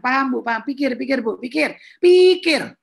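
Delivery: mocking staff.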